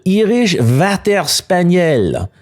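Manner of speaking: put-on Irish Water Spaniel said with a French accent, "vater spanyel". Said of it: "my friend in France pronouncing".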